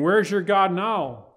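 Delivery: taunting the king's men, slow and high; mocking taunt. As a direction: sneer it out slowly and loud. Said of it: "He's mocking him".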